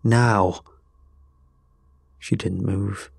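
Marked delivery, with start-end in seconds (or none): 0.0-0.6 curt, urgent command; barked order. A narrator's reading. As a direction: bark the single word firmly. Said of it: "I barked".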